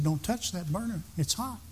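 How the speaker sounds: markedly soft, matter-of-fact warning; not yelling. Retold or imitated. Mama just telling her son about the burner, quietly. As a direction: say it softly, no yell at all. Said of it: "She didn't yell it. She just said".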